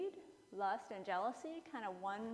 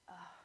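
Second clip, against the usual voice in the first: whispered exasperated sigh; 4 semitones lower, 12 dB quieter than usual. Retold or imitated. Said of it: "And he's like".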